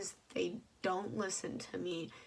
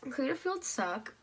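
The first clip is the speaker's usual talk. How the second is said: ordinary talk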